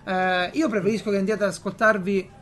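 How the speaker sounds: normal talking voice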